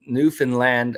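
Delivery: Newfoundland with a full "land" ending; rhymes with understand. Shown as a demonstration. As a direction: say Newfoundland as new-fin-LAND. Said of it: "Rhymes with understand".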